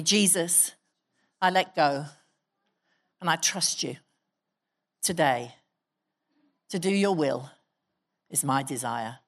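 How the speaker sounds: spoken prayer led for the room, paced with pauses. Acted. Leads the congregation in prayer.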